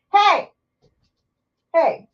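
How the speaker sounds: sharp, loud call of "hey"; scolding the dog. Real, not acted. Vlogger breaks off to check the dog, sharp call.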